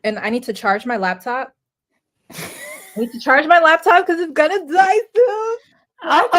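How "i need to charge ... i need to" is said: much higher and louder playful repeat. Acted. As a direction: toss it up high and loud, teasing. Repeats the line for fun.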